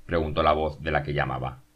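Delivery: ordinary delivery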